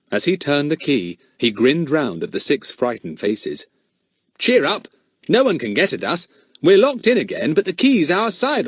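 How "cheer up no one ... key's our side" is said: cheery reassurance. A narrator's reading. Fatty cheers up frightened children.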